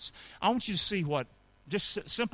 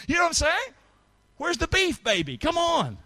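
ordinary talk

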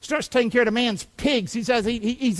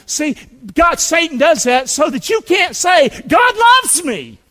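belted declaration, much higher, landing slowly on the last words; rising shout; 7 semitones higher, 7 dB louder than usual. Real, not acted. Preacher declares God's love against Satan, shouting. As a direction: build to a high, loud shout.

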